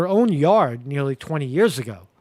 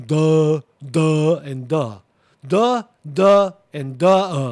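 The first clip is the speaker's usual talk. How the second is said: loud, dopey "duh" sounds to mock the kids as dim; drawn-out, goofy "duh" with the jaw hanging; mocking dumb grunt; 6 dB louder, much slower than usual.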